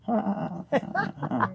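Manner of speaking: high, spaced-out fake baby laugh; imitated mocking laugh. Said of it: "she laughed like this. She went".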